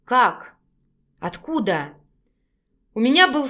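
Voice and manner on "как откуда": hushed surprise, dropping lower on the second question; whispered. A narrator's reading. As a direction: ask under your breath, taken aback. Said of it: "и тихо прошептала". (тихим удивлённым шёпотом)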